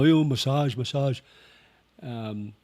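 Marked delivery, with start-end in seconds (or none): 0.0-1.2 higher, lightly imitated sing-song pitch; ladyboy touting. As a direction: coax a passer-by in for a massage. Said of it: "and they go".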